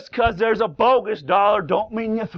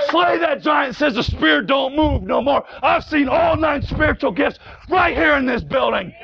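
fiery preaching; 6 semitones higher than usual. Acted. Preacher rousing the congregation against formalism, full-force shouting. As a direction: preach it like a battle cry.

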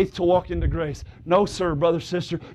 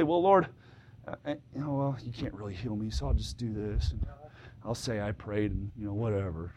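much lower, much softer, mumbled mock prayer; hushed, low imitation of a doubter; 10 semitones lower, 11 dB quieter than usual. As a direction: mimic a lukewarm believer shrugging it off. Mocking half-hearted doubters' prayer.

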